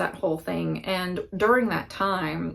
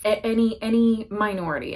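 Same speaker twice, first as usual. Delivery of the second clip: usual speaking voice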